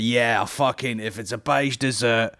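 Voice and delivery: slow, relishing mock judge; lightly hammed-up food-critic drawl; Greg Wallace impression. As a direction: drag it out like a greedy critic. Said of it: "And he's like".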